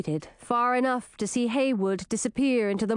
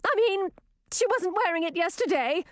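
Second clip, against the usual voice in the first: high-pitched princess voice; high, defensive princess; princess backpedaling; 9 semitones higher than usual. A narrator's reading. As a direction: add a hasty correction, pitched well up. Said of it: "she added".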